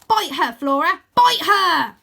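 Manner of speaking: loud ringside yelling, building; shouted tactical advice. A narrator's reading. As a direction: egg on a fighter at full voice. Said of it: "offering tactical advice like".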